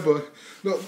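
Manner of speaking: usual speaking voice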